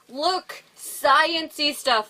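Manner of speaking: lightly voiced marvelling kid; excited kids. Kids showing off strange science, lightly voiced excitement. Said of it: "the kids are like".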